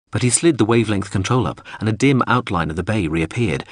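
ordinary delivery, nothing marked

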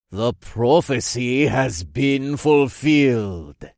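slow, ominous cult-leader voice. A narrator's reading. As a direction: intone it slowly like a dark prophecy. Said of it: "said in an ominous tone".

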